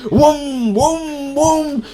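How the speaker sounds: high, rising engine-rev noises; engine revving imitation. Rev it with rising high sounds. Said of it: "revving the fuck out of it".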